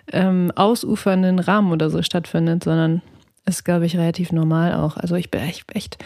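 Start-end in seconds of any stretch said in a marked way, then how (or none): 5.0-6.0 whispering. Real, not acted. Hesitant admission about spending.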